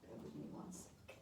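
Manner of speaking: soft whisper, off to the side; whispered aside. Real, not acted. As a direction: breathe it as a quick aside. Aside while fixing setup.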